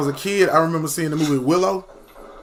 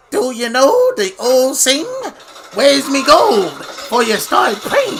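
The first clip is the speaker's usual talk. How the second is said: high, slow, louder leprechaun rhyme; 9 semitones higher, 4 dB louder, slower than usual. Retold or imitated. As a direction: recite the rhyme like a menacing leprechaun. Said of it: "the way he would rhyme".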